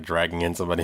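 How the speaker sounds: usual speaking voice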